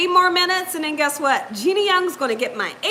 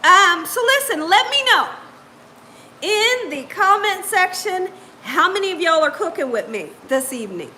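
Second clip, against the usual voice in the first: ordinary talk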